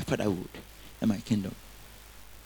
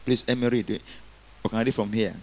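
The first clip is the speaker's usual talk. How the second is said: normal talking voice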